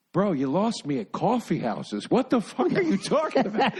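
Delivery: a lightly voiced Pete, rising into a high incredulous laugh; mock baffled comeback that breaks up laughing; imitating Pete. Comic retelling Pete's retort to a rant, laughing incredulity. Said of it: "He goes".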